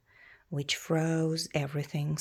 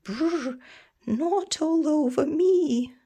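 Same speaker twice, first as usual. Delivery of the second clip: higher, louder cold complaint; shivering protest; 9 semitones higher, 6 dB louder than usual. Acted. Creature being frosted by the dragon, shivering protest.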